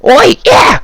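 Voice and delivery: rally to fight; a high goblin yell; goblin battle cry. Acted.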